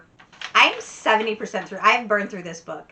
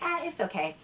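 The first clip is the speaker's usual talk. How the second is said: unimpressed shrug; 7 semitones higher, 6 dB quieter than usual. Retold or imitated. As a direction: shrug it off like it's nothing special. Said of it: "some people are just like".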